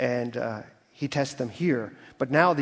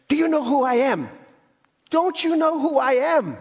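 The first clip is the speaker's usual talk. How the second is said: much higher, louder, outraged yell; entitled furious shout; 12 semitones higher, 5 dB louder than usual. Retold or imitated. Passenger yelling at the gate agent, furious and entitled. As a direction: bellow like a self-important man demanding service. Angry passenger slams the counter.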